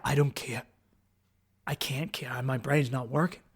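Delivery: low, very soft reply; quiet, drained admission; helpless surrender. Retold or imitated. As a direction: give up, voice dropped right down. Retells helpless reply to warnings.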